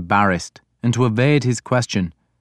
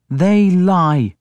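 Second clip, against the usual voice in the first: higher-pitched, firm flat denial; 9 semitones higher, 4 dB louder than usual. A narrator's reading. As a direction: deny it outright in a raised pitch. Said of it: "Breda said flatly".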